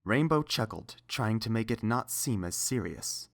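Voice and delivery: ordinary delivery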